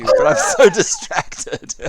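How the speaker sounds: slow words broken up by real laughter; giggling admission of being distracted; laughing. Real, not acted. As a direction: laugh through the line, drawn out. Distracted while gaming and laughing.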